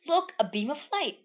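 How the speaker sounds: put-on high-pitched excited-girl character read; lilting high character voice; Marina's voice. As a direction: pipe it up high for a cartoon character. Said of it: "says Marina".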